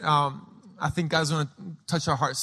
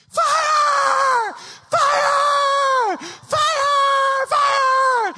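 very high, loud drawn-out shrieks; little girl yelling; 6 dB louder, much slower than usual. Retold or imitated. Four-year-old yelling over the window side, piercing scream. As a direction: scream it long like a child in a game. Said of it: "she starts yelling".

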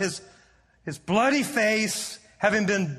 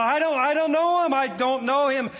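higher-pitched, hurried mock denial; insistent denial; 7 semitones higher than usual. Preacher voices the disciple denying Jesus, insistent. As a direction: deny it as a preacher acting out Peter. Acting out Peter's first denial.

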